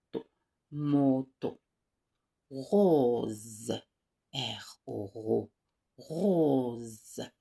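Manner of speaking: loud, slow teacher drill, each word held; repeat-after-me drill. Shown as a demonstration. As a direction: model every word for the class to repeat. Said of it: "On répète ensemble". (voix de maîtresse qui fait répéter)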